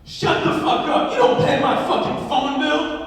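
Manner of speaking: loud, high mock outburst; acted angry comeback. Man on the phone silences the mom, yelling angrily. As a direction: shout down the mom in defiance. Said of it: "He goes".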